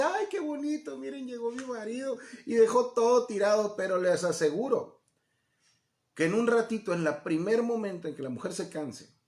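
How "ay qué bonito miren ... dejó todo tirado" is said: high-pitched, doting wife quote; delighted new wife. Acted. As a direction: coo it like an adoring newlywed. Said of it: "a la mujer se le hace".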